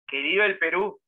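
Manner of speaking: Melgar's defiant last shout; defiant cry. Retold or imitated. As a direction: hurl it back at the firing squad. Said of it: "lo miró y dijo".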